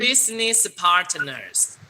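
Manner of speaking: slow, even model reading; slow read-along. Shown as a demonstration. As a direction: lead the class word by word. (慢速领读)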